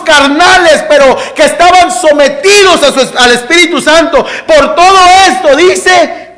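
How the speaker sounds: full-force pulpit preaching; fiery preaching. Acted. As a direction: preach it to the back row. Preaching Hebrews verse at full force.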